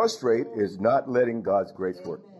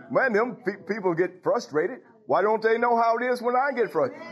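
a mildly raised, exasperated voice of God; God complaining; 8 semitones higher than usual. Acted. Preacher voicing God, lightly exasperated at people. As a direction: play God, fed up with people.

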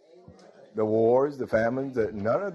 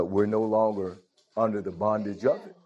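ordinary delivery

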